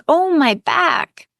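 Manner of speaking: high, slow moan of pain. Acted. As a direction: ache through every word. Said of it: "Then she moans".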